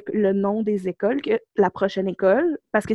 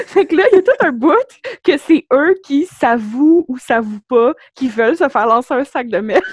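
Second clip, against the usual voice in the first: laughing; 4 semitones higher, 6 dB louder than usual. Real, not acted. Laughing through the punchline.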